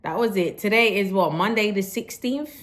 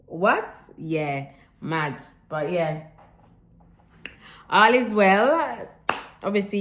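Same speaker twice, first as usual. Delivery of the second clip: ordinary delivery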